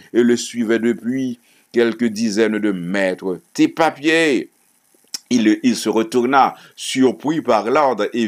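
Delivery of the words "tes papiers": higher-pitched sudden demand; police order. A narrator's reading. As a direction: read it as an officer's curt command. Policeman orders a man to halt. (ordre sec de policier)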